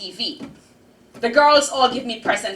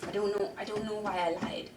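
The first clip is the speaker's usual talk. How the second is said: soft, ashamed mumble with head hung; a small girl owning up quietly to her aunt; meek confession; 11 dB quieter than usual. Acted. Child answers aunt quietly, ashamed. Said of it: "I hang my head and I tell her".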